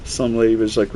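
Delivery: ordinary talk, nothing marked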